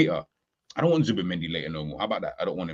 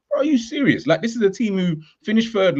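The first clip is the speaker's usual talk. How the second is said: incredulous; 11 semitones higher, 4 dB louder than usual. Real, not acted. Mocking rival's complacency.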